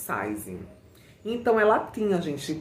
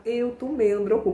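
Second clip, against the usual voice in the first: higher, delicate Xuxa-style voice; little Xuxa voice; 6 semitones higher than usual. Retold or imitated. Priscila telling the crowd what she took, in a higher, delicate Xuxa voice. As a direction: say it sweetly, like a children's TV host. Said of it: "com aquela vozinha assim da Xuxa".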